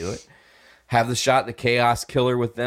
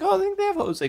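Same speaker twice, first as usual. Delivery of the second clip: high voice; faster than usual. Retold or imitated. Retold reply to roommate.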